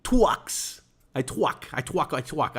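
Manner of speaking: put-on twucks and twuck said with a w for r, babyish; "twuck" for truck, baby voice; cutesy baby talk. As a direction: lisp "I twuck" like a toddler. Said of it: "I do the talky talk".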